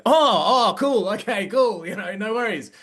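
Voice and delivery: high, suddenly friendly backpedal. Retold or imitated. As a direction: gush as if you just changed your mind. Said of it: "they were like".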